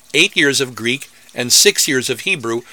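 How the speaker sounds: ordinary talk, nothing marked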